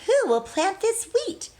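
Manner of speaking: hen crying out. Acted. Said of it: "cried the little red hen".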